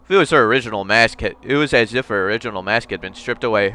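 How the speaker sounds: normal talking voice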